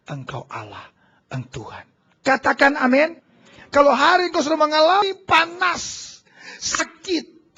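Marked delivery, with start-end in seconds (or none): 0.1-1.9 much lower, much softer worshipful words; bow down and say it in awe; reverent worship. Acted. Israelites bowing before God, hushed low worship. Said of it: "sujud dan berkata".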